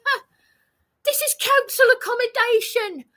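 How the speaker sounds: retelling her answer to the officers, crying; tearful terrified cry. Retold or imitated. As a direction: cry out in real fear. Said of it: "I said".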